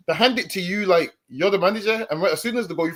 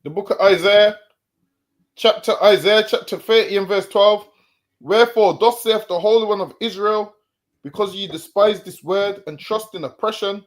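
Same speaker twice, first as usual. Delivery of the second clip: loud, solemn Bible reading; loud formal reading of Isaiah; scripture reading; 4 dB louder than usual. Acted. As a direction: proclaim the verse to the listeners. Reads scripture aloud.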